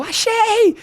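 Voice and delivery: high, breathless mock bride babbling; excited bride. Bride calling groom after finding rings, high giddy babble.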